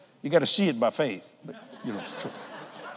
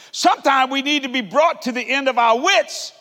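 higher, louder preacher's cry, stretched out; full-force preaching; 10 semitones higher, 6 dB louder than usual. Acted. Preacher proclaiming to his congregation, full-force shout. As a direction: preach it out to the whole room.

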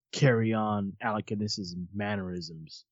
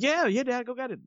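high-pitched imitation of an eager boy; eager kid imitation; 12 semitones higher than usual. Kid answering dad's popcorn offer, high and eager. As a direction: play your younger self, much higher.

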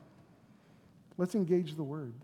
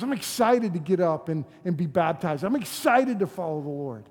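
retold excitement, pushed out loud; excited retelling; 8 dB louder than usual. Preacher quoting a man about to be baptized, excited. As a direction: imitate an eager new believer, louder.

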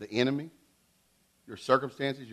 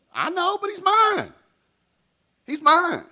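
high, drawn-out tender claim, slow; Jesus claiming his own; slower than usual. Retold or imitated. Jesus answering the devil, high and slow: he's mine. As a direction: hold the word mine high and long. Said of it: "God's looking at the people".

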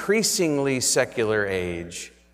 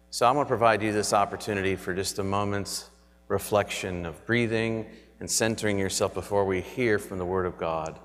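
sleep story narrator. Acted. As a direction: guide them gently into stillness. Leads breathing reflection.

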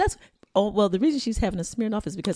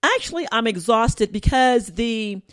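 loud, slow, raised-pitch imitation; Mira; 4 semitones higher, 6 dB louder, slower than usual. Imitated child telling aunt about being tired, slow and loud. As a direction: mimic a child explaining, drawn out and loud. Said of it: "And here's Mira".

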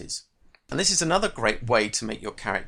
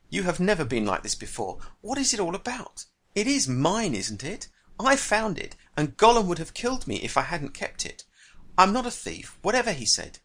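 higher, rising indignation; indignant protest; 7 semitones higher than usual. A narrator's reading. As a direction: defend your claim, getting worked up. Bilbo arguing over the ring.

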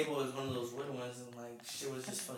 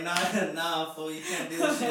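talking through real laughter; slightly raised voice breaking into laughs; laughing; 3 semitones higher, 4 dB louder than usual. Real, not acted. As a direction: laugh your way through the line. Laughing while retelling wedding panic.

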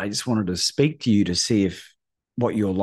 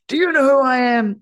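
very high, loud mock bellow; imitated shout; 5 dB louder than usual. Coach bellowing a challenge at the caller, high and loud. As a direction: hammer the challenge like a booming coach.

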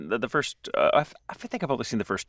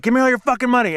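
higher, louder acted stickup shout; mock robber's demand; 9 semitones higher, 6 dB louder, faster than usual. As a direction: bark it like a pretend armed robber.

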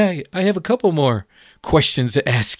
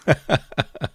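real chuckle; 4 dB quieter than usual. Real, not acted. Preacher confessing we just don't do well, soft chuckle. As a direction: chuckle at your own failing. Laughs at own admission.

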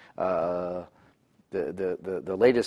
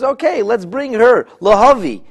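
scheming king; 11 semitones higher, 9 dB louder than usual. Retold or imitated. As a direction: call for her grandly. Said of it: "now he says".

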